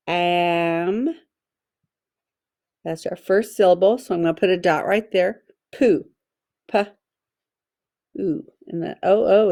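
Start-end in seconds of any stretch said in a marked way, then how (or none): 0.1-1.1 "am" stretched out long on its own; sounded-out chunk. Shown as a demonstration. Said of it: "Let's tap that out". 6.7-8.4 "P" and "oo" split by a long pause; sounded out. Shown as a demonstration. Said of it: "Let's tap that out".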